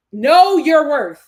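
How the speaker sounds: loud, drawn-out declaration; slow, loud and emphatic, each word stretched; emphatic call-out. Real, not acted. Emphatic cheer after reading comment.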